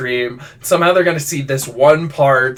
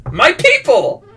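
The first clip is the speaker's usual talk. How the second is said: high, loud, playful cheer; mock rallying cry; 12 semitones higher, 5 dB louder, slower than usual. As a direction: call out to your crowd in mock triumph. Mock proud cry about rednecks, junkies.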